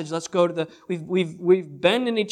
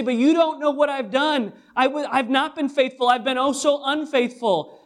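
much higher, louder pleading voice of a guilty believer; doubting believer; 9 semitones higher, 6 dB louder than usual. Retold or imitated. Preacher voicing a guilty listener protesting their failures, high and pleading. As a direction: plead your own unworthiness. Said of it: "You say".

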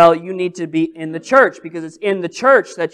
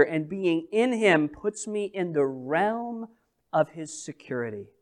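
soft reassurance; 3 semitones lower, 10 dB quieter than usual. Real, not acted. Closing reassurance.

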